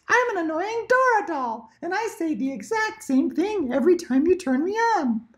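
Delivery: very high squeaky toy doll voice; annoying talking doll. Acted. Mom imitating an annoying talking doll, very high.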